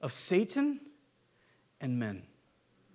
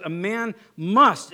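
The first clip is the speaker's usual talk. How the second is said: MUST stressed slow and louder; emphatic must; 6 dB louder, much slower than usual. Shown as a demonstration. Preacher stressing Paul's command, "must" emphatic. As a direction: lean hard on "must", drawn out. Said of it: "he uses the emphatic".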